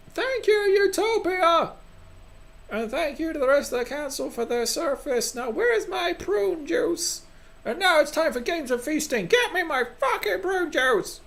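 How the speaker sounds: very high, hammed-up proclamation; cranky council head. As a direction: squawk formal thanks, then grumpy demands.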